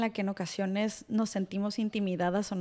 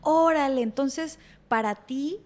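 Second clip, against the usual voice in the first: higher, louder dawning "oh, I see"; mock realization; 5 semitones higher, 5 dB louder, slower than usual. Therapist voices a realization to a client, mildly amazed. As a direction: exclaim it as a sudden insight, then slow down.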